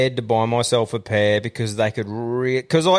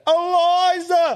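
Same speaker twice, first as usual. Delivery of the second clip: long, high drawn-out call; hammed-up high holler; mock yelling; much slower than usual. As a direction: yell for a lost dog, stretching the name right out. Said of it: "having to yell out".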